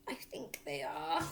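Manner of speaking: whispered, slow and unvoiced; father frozen with fear; scared whisper. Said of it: "said the father, sitting tight".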